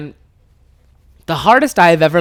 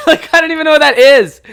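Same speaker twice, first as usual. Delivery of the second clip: high, loud, through laughter; real laughing exclamation; 11 semitones higher, 8 dB louder than usual. Real, not acted. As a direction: burst out laughing into the words. Cracking up at own story.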